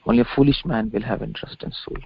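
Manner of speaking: ordinary talk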